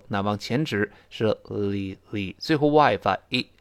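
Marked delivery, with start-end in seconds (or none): 1.5-2.4 li said slowly with the tongue tip pointing forward, not curled; teacher demo of li with the tongue pointing forward. Shown as a demonstration. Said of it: "舌尖不能勾舌，那往前指是li".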